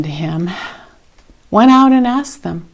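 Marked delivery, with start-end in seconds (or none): none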